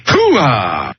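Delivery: barked army cheer. Acted. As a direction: shout it like a gung-ho grunt. Caller's military cheer.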